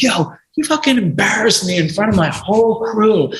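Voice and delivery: high-pitched imitated outrage; indignant confrontation. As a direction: tell someone off in mock indignation. Boss confronts him in the street.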